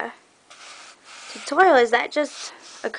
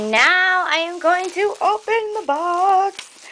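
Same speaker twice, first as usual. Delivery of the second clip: giddy, raised-pitch anticipation; excited build-up; 6 semitones higher than usual. Real, not acted. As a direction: build suspense before the big reveal. Building up to opening the box.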